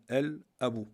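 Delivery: "Al-Abu" with a clear, separate L; lam pronounced. Shown as a demonstration. Teacher proving that lam is pronounced, L sounded clearly. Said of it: "on le prononce le lem".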